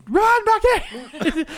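very high, stretched-out call; mock warning holler. Teller urging friend to escape, high drawn-out mock holler. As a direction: call after him in comic desperation.

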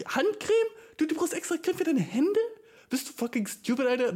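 can't believe this exists; incredulous. Real, not acted. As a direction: voice jumps up in disbelief. Incredulous about hand cream. (fassungslos hoch)

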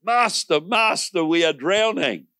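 high, frantic cry of Master; panicked shout. Acted. Disciples waking Jesus in the storm, a high frantic shout. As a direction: call out like frightened men in a storm.

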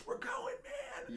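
put-on very high, slow, drawn-out old-timer voice; a thrilled elderly fan, high and slow; imitating old Buffalo fan. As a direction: stretch it out like a giddy old fan. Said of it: "and they were like".

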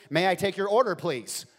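loud, bright customer-service voice; cheery cashier. Pretend. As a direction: sell it like a smiling fast-food worker.

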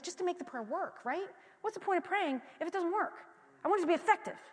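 high, lightly plaintive questioning; mock frustration. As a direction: voice a mildly exasperated complaint. Voices past frustration with prayer.

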